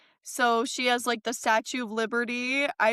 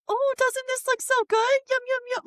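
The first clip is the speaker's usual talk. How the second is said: very high sing-song coaxing voice; baby talk to a dog; 13 semitones higher than usual. Retold or imitated. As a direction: coax a picky dog, high and sweet. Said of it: "I'm like".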